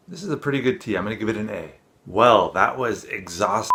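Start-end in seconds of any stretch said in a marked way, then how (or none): 2.0-3.7 weary slip. Pretend. Slips, then corrects to wonderful.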